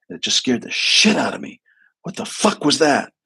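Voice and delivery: a hint of a rattled veteran; shaken and scared. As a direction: let the panic creep into the question. Client retells seeing the entity.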